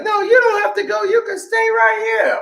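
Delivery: very high mock-female voice; imitated insistent girlfriend. Girl telling her friend to stay, high insistent imitation. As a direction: insist in an exaggerated falsetto.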